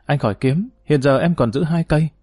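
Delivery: ordinary delivery, nothing marked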